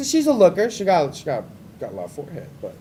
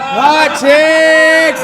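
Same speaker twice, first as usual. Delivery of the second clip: hype shout; 6 semitones higher, 7 dB louder than usual. Acted. Host hypes audience.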